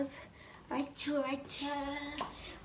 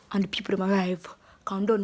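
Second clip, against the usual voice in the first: ordinary talk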